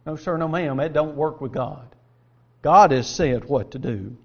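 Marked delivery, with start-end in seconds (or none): none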